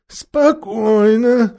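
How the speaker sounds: slow, drawn-out high voice; steadying each other. A narrator's reading. Last of three onlookers telling another, drawn-out calming. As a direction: stretch it out to steady your companions. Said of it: "каждый из трёх зрителей".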